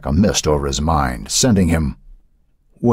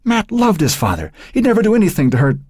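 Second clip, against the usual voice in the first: high, fast protective outburst; mother's outburst. Acted. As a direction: defend her son in a rising rush. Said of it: "fend off her outburst".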